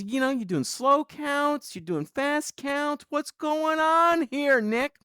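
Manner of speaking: lightly mocking, slow high questioning; imitating suspicious interviewer. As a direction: pitch it up and stretch out the accusing questions.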